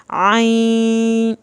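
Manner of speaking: "عین" held long, ending in a lightly hummed nasal n; light ghunna. Shown as a demonstration. Teacher explaining ghunna, nasal hum on drawn-out "عین". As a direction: stretch "عین" and let the n ring in the nose. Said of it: "ہلکا سا غنہ کرنا ہے".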